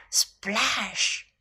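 soft, low, whispery splash word; whispered sound effect. A narrator's reading. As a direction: hush it like spray settling. Narrator voices the splash.

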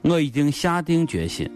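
normal talking voice

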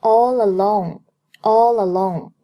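"all alone" joined, the l carried into "alone"; linked pronunciation. Shown as a demonstration. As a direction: run "all alone" together as one word. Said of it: "All和alone连读".

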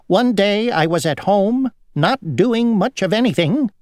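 high, singsong storytelling fly; fussy housefly. A narrator's reading. Housefly to Grasshopper about a day at home, high lilting voice.

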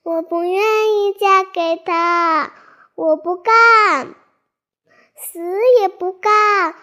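loud tearful refusal, high; crying protest. Acted. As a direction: wail it out in tears. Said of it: "不禁大哭起来".

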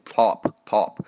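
slow American "top", vowel opened wide; exaggerated American accent. Shown as a demonstration. Teacher shows the exaggerated version, American accent with wide vowel. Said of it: "美国人会读比较夸张的那种".

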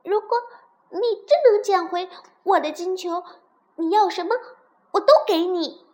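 high, loud, eager princess; excited promise. Acted. Princess promises frog anything for golden ball, excited. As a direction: promise it all with delight.

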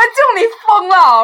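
loud, high, drawn-out retold outcry; shocked protest. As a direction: protest as if the idea is crazy. Retold shock at uncle's question.

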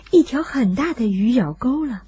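plain reading voice

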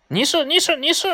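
high parrot squawk, taunting retort; parrot yelling back. A narrator's reading. Parrot yells back at the puppy, high squawking taunt. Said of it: "绿鹦鹉冲他叫".

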